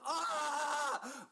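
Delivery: high yelled death scream; death cry. Shown as a demonstration. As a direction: let out a dying yell. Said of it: "Most people go with".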